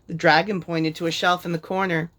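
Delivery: plain reading voice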